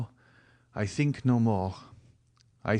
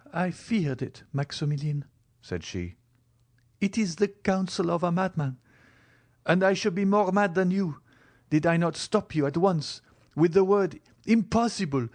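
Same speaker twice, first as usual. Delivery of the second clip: put-on young woman refusing; 8 semitones higher than usual. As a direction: stop him at once. Valentine refuses his plan.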